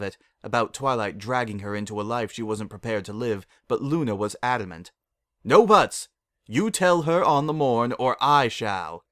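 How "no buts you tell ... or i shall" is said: loud, higher stern command; a princess laying down the law, louder; stern order. A narrator's reading. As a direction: cut off the protest loudly. Luna demands sternly.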